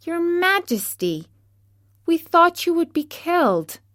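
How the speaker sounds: high-pitched, squeaky mouse subjects; excited little mouse voice; exclaiming mice. A narrator's reading. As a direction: exclaim in relief to your queen, high and squeaky. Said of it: "they exclaimed".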